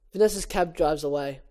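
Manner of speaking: ordinary talk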